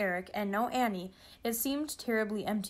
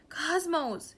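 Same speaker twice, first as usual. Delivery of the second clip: high-pitched, urgent call; shouted; 7 semitones higher than usual. A narrator's reading. George calls to the computer, shouting. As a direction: cry out the name, pitched up. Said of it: "shouted George".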